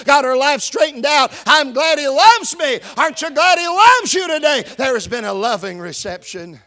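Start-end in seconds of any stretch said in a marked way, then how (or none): 0.1-4.6 rising, louder preacher's cry; preaching at full force. Acted. Pastor stirring the church with growing fervor, louder and higher. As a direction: lift the congregation with it.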